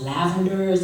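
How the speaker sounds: ordinary delivery, nothing marked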